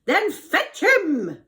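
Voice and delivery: high, loud, slow command; commanding Pharaoh. Acted. Pharaoh orders Joseph fetched, imperious and loud. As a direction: order it grandly as a king. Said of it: "Pharaoh told him".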